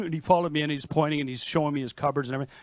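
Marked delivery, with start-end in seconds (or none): none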